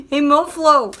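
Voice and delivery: calling out, still amused; cheery laughing hey; laughing hello. Real, not acted. Calls out to Mo again.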